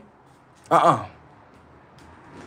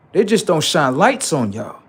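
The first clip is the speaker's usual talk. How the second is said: ordinary delivery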